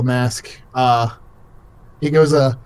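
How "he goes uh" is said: ordinary talk, nothing marked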